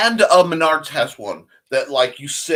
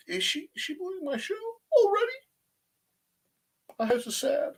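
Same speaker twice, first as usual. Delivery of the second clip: mock whine; 5 dB quieter than usual. Mock hurt at being booed.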